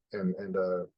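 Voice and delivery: ordinary delivery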